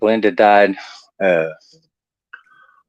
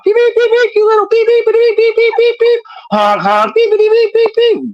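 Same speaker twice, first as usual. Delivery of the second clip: very high, loud bleeped tirade; hammed-up road-rage beeping; cussing grandma; 4 dB louder than usual. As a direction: fire off bleeps in a shrieky granny voice. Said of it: "cuss word name flying out of her mouth".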